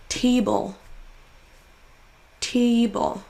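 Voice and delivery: TA in "Table" held long; stressed syllable drawn out. Shown as a demonstration. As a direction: lean on the first syllable of "Table". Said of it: "en faisant traîner un". (première syllabe de « Table » traînée)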